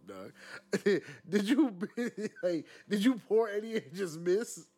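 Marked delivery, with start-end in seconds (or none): 0.7-4.7 high-pitched, laughing question; real laughter breaking through the words. Real, not acted. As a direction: ask it while cracking up. Cracking up over the spilled drink.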